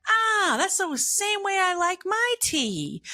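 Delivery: high, slow put-on cashier voice; voicing the cashier. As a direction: play the young cashier, delighted. Cashier voiced in retold story.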